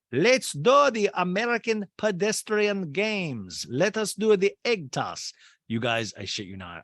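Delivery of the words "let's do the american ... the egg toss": high, slow mock-German announcement; German accent. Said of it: "[name] in a German accent goes".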